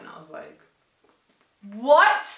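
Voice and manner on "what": loud, high shocked what. Retold or imitated. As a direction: blurt it out in disbelief. Shocked reaction.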